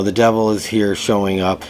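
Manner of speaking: usual speaking voice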